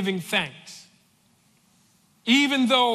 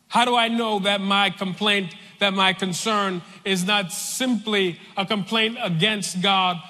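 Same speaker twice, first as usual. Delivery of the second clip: ordinary delivery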